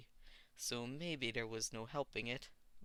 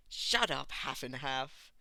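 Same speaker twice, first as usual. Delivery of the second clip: embarrassed shout; 8 dB louder, slower than usual. A narrator's reading. Bakugo yelling to silence Todoroki, flustered. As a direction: shout him down to hide embarrassment.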